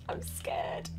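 frightened, barely voiced; scared whisper. Real, not acted. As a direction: whisper it as if hiding.

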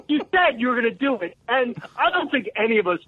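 ordinary talk, nothing marked

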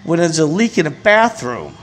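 high-pitched, incredulous rant; real indignation. Real, not acted. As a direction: let outrage push the pitch right up. Indignant rant at pastor closing doors.

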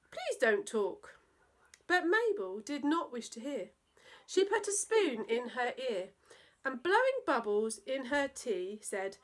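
put-on lively rhyming read-aloud for children; playful children's story narration; storytelling voice. A narrator's reading. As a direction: bounce through the verse like a bedtime poem. Reads a silly poem to children.

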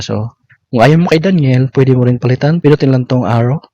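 normal talking voice

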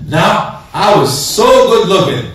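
vain excuse. Acted. Preacher mimics a vain man's excuse for not praying, mock vain boast. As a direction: preen over your looks.

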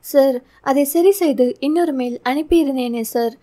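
ordinary delivery

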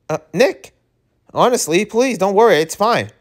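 high-pitched, hurried pleading; flustered reassurance. Acted. Boy telling his friend not to worry, high and flustered.

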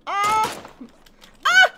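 repeated high, loud screams like a siren; alarmed screams. Real, not acted. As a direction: shriek over and over like an alarm. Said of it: "It's like an alarm".

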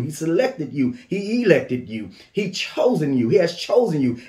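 ordinary talk, nothing marked

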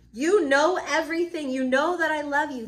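loud, high, emphatic protest; exasperated outburst. Acted. Peter protests to Jesus at the third asking, exasperated. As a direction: burst out insistently, at full voice.

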